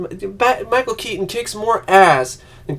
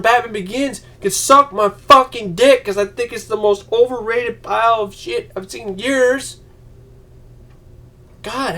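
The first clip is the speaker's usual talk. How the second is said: ordinary delivery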